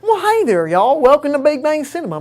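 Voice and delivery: sweet Southern belle hello. Real, not acted. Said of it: "talking like a Southern belle right now".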